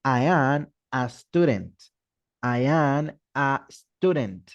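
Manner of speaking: slow and careful, stressing am; slow English drill with clear articles; slow model sentence. Shown as a demonstration. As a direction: drill the sentence slowly for students. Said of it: "tengo que utilizar el artículo".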